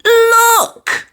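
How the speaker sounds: high, loud excited shout; a child spotting something thrilling; sudden squeal of discovery; excited cry. A narrator's reading. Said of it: "she cried".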